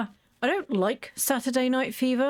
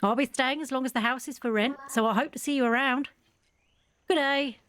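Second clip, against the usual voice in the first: a hammed-up Australian accent, higher; terrible Australian accent; 4 semitones higher than usual. Sim tenant saying goodbye to the player, in a hammed-up Australian accent. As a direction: keep the bad Aussie accent going to the goodbye. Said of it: "sorry for the terrible accent".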